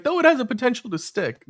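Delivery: high-pitched movie-line quote; imitated protest, pitched well up; quoted character's line. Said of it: "He's like".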